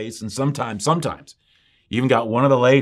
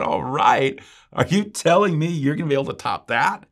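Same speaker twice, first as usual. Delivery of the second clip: higher, louder incredulous challenge; indignant retort; 5 semitones higher, 3 dB louder than usual. Retold or imitated. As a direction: throw it back as a disbelieving challenge. Challenged preacher retorts to pastor.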